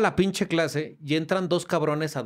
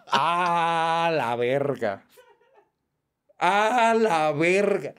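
laughing disbelief; much slower than usual. Real, not acted. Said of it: "No mames la de risa".

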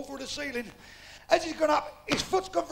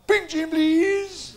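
slow, stretched military shout; mock guardsman; 3 semitones higher, 5 dB louder, much slower than usual. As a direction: order a drink like a drill command. Customer now dressed as a guardsman.

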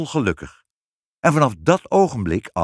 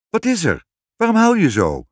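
high-pitched, louder question to a tearful husband; concerned questioning; 9 semitones higher, 4 dB louder than usual. A narrator's reading. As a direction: ask with startled concern, pitched up. Queen questions her weeping husband.

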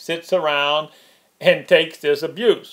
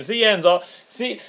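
normal talking voice

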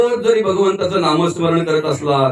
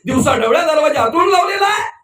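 high-pitched outraged husband at the door; indignant outburst; 9 semitones higher than usual. Acted. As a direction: demand to know why it is locked, pitched high. Acted Ravana-like man at locked door. (चढ्या आवाजात संतापून)

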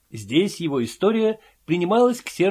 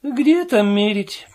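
sad; 6 semitones higher, 4 dB louder, slower than usual. A narrator's reading. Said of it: "сказал Филюрин грустно".

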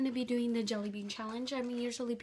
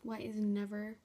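ordinary talk, nothing marked

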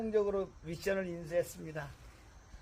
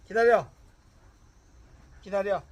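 loud stern wait order; firm dog commands; 17 dB louder, faster than usual. Real, not acted. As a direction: make the dog stay.

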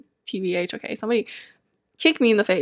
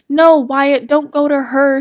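scolding call; 4 semitones higher, 8 dB louder, slower than usual. Real, not acted. Scolding her Sim Wyatt.

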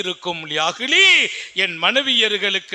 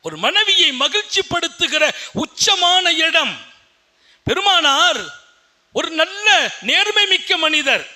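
higher, raised sermon voice; fervent preaching; 8 semitones higher, 4 dB louder than usual. Acted. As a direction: proclaim it to the congregation. Preaching at full force. (உரத்த பிரசங்க குரல்)

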